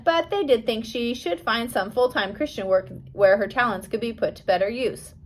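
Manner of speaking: ordinary delivery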